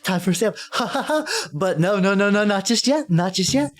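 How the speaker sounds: high, gleeful teasing clown; happy clown voice. Acted. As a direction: cackle and tease in a high clown voice. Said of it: "She's like".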